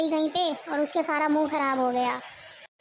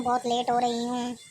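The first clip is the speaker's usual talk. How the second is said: ordinary talk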